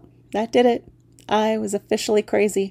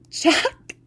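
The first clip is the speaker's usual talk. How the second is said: much higher, delighted cry; joyful recognition; 9 semitones higher than usual. Acted. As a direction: call out his name in delight. Recognizing her little friend.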